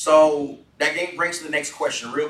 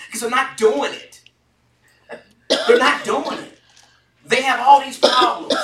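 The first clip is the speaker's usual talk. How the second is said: higher-pitched, emphatic preacher's delivery; impassioned preaching; 5 semitones higher, 4 dB louder than usual. Real, not acted. Preacher challenging the class on Paul's church, impassioned. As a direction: drive each charge home, pitched up.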